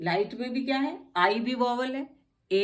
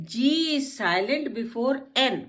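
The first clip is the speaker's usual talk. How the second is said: very slow, louder rule read; slow rule dictation; 4 semitones higher, 4 dB louder, much slower than usual. Shown as a demonstration. Teacher dictating the silent G rule slowly. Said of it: "अब आ जाता है जी".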